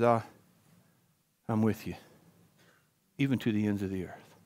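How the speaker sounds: quiet, soft promise; soft reassurance. Acted. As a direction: whisper comfort like God. God voiced softly.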